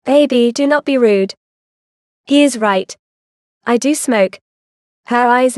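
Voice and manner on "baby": usual speaking voice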